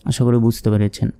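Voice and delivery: ordinary delivery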